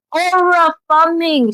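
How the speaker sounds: high, drawn-out whoop of admiration; hyped cheer. Real, not acted. Back to hyping Sarah after the aside, excited. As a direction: sing out the words, stretched and high.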